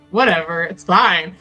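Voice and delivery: ordinary delivery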